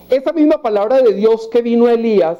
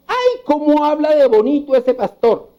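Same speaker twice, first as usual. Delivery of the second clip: higher, lightly voiced admiring listener; mock a fan swooning over the preacher; a hint of a starry-eyed congregant; gushing churchgoer; 7 semitones higher than usual.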